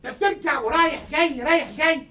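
loud, heated scolding; angry accusation. Real, not acted. Confronting a stranger who keeps passing, angry. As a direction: come at them loudly, accusing.

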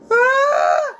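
sudden high shriek; startled scream. Real, not acted. Player jump-scared, a sudden high shriek.

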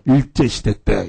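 low, slow deadpan aside; drawn-out mock solemnity; mock-serious. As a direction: imitate a teacher stating it gravely. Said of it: "이거를 굉장히 진지하게".

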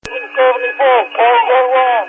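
yelling over roaring hose noise; over a fire hose. Real, not acted. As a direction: shout to be heard over water. Chief yelling on fireground radio.

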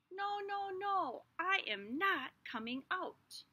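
high, stubborn little seed; no, no, no, I won't; childish refusal. Acted.

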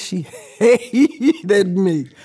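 laughs through the words; half-laughing disbelief; a real laugh breaking into the line. Real, not acted. Laughing while recounting coach.

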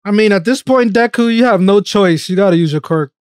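normal talking voice

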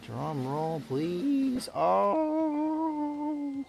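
high, loud drawn-out "oh" of suspense; drum-roll build-up. Pretend. Gamer awaiting the gear drop, building excitedly.

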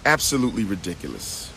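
normal talking voice

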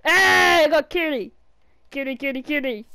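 excited. Real, not acted. Excited to catch cat.

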